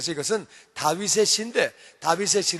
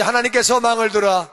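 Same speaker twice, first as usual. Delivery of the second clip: louder, declaring it with the congregation; proclaimed together; 5 dB louder than usual. Shown as a demonstration. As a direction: proclaim it boldly all together. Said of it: "다 같이". (회중과 함께 힘차게 선포하듯)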